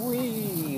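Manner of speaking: soft, high, drawn-out whee; quiet little squeal of mock thrill; playful whee. Real, not acted. Driver turning the car around, playful whee.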